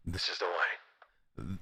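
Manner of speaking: low, quiet, very slow solemn line; low, slow Mando catchphrase; Mando impression. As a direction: intone it like a stoic bounty hunter. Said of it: "Mando, Mando still".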